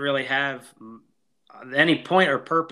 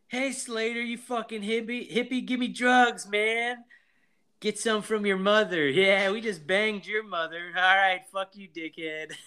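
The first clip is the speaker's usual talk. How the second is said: high, put-on slacker voices trading insults; imitated movie banter; 7 semitones higher than usual. Podcaster acting out teens heckling Slater, high put-on voices. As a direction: quote the exchange in a raised mock voice. Acts out rude movie exchange.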